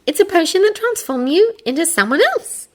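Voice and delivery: put-on high girl voice, explaining. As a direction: explain it patiently. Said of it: "Hermione explained".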